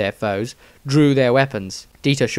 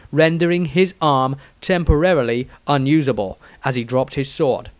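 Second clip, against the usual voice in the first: ordinary delivery, nothing marked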